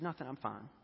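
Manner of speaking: soft, low, understated reply; holding back tears. Retold or imitated. Wife brushing off husband's question, quietly holding back tears. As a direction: gently imitate someone hiding that they are upset. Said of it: "she was holding back tears".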